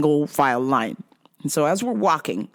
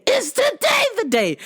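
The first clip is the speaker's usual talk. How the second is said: eager mock prayer. Eager for a fight.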